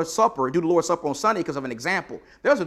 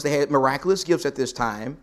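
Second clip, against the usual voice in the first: normal talking voice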